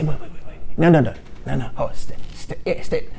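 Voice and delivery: soft urgent wait wait; whisper-beg the customer not to leave; hushed pleading salesman. Acted. Salesman begging the buyer to stay, hushed and urgent.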